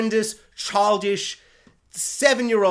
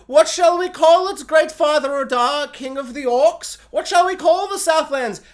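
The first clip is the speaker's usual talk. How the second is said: high, louder, slow pompous servant voice; mock grovelling old man; 8 semitones higher, 5 dB louder than usual. Mocking the old man asking the king, high slow fawning voice. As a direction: ask it like a fawning old lackey. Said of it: "he's like".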